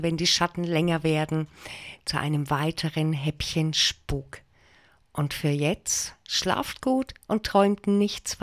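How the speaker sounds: low, hushed spooky sign-off; vintage horror narrator. Acted. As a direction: murmur the goodbye like a haunted host.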